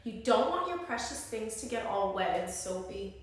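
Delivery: usual speaking voice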